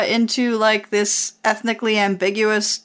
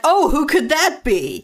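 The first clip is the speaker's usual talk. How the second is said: high-pitched, oblivious questioning; mock innocent curiosity; 7 semitones higher than usual. [name] asks Rio about his other love, mock innocent. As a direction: lift it high as if truly clueless.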